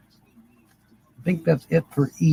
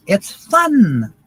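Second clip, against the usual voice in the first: put-on kid's voice; 6 semitones higher than usual. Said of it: "this little kid goes".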